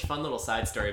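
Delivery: ordinary delivery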